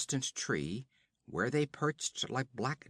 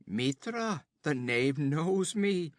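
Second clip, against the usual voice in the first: high, loud amazed outburst; surprised exclamation; 5 semitones higher, 4 dB louder, slower than usual. Acted. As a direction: exclaim it in startled wonder. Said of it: "exclaimed the other".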